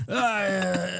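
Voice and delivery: high, soft, hammed-up moan of ecstasy; exaggerated moan. Mocking the British actor's ecstasy moan, exaggerated. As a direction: moan it like a dying soldier. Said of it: "moans of ecstasy".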